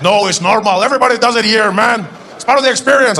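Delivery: high, loud, animated explaining; taco vendor. Acted. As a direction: call it out as if obvious. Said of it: "He said".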